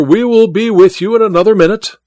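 reassuring call through a door. A narrator's reading. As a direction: keep calling to the prisoner. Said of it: "said Welton through the door".

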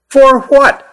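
loud, high challenge. A narrator's reading. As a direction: shout it out. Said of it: "said Pat loudly".

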